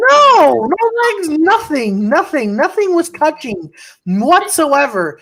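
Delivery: high, drawn-out indignant protest; flustered, emphatic self-defence; emphatic denial. Real, not acted. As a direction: deny it slowly, word by word. Indignant denial of teasing accusation.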